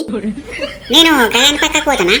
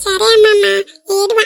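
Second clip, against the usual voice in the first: put-on crying child; 5 semitones higher than usual. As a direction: wail it like a crying kid.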